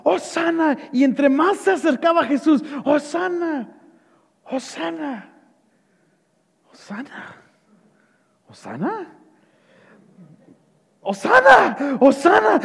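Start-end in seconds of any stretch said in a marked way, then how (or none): 0.0-0.8 high mock cheer without much volume; crowd shouting. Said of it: "que estaban gritando". 2.8-9.1 faltering cheer. Acted. Crowd's cheer falters as Jesus nears. 11.0-12.6 high, sudden burst of cheering; crowd shouting. Acted. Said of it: "que estaban gritando".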